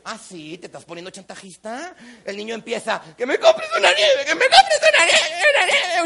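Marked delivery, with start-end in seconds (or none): 0.0-1.9 lower, quiet mock suspicion; sly challenge. Accusing the other of blackmail, quietly sly. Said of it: "le dice". 3.2-6.0 very high, loud wailing; child's tantrum. Retold or imitated. Boy throws a tantrum for ice cream, hammed-up screaming. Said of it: "si sigues haciendo berrinche".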